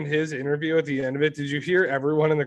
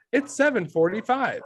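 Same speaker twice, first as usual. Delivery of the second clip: mimicking Siri; 8 semitones higher than usual. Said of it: "and it's like".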